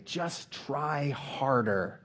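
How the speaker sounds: high, drawn-out urging; mock encouragement. Preacher imitates urging the disciples on, high and drawn out. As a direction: stretch it out like a pep talk.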